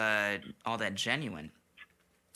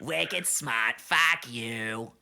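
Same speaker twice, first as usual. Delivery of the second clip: loud mock Bostonian; hammed-up Boston tough guy; Boston accent; 4 dB louder, much slower than usual. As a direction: swagger through a broad Boston accent. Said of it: "guy from Boston".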